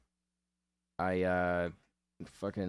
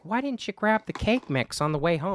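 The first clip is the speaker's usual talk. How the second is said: high, loud put-on wife voice; nagging wife; 12 semitones higher, 6 dB louder than usual. Wife scolding husband about the cake mix, high mock nag. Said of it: "and she's like".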